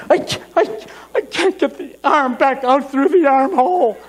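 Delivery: very high, strained helpless wail; hammed-up desperation, words forced out; panicked wail. Man trapped in his undershirt, wailing in panic.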